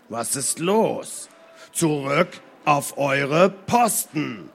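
lauter, langsam, jedes Wort betont; nachdrücklicher Befehl. Acted. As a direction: ungeduldig den Befehl wiederholen. Tagas wiederholt den Befehl. (eindringlich wiederholter Befehl)

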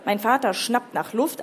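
ordinary delivery

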